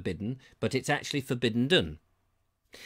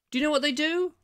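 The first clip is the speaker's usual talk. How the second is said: teasing question, pitched high; 11 semitones higher, faster than usual. Real, not acted. Teasing the viewers before a reveal, high and playful. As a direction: dangle the question like a showman.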